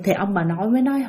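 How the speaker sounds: ordinary delivery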